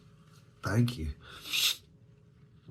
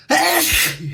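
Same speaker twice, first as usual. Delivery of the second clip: sneezing; 14 dB louder than usual. Real, not acted. Sneeze breaking into thanks.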